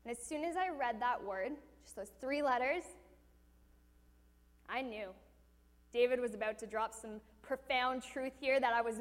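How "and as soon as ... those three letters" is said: ordinary delivery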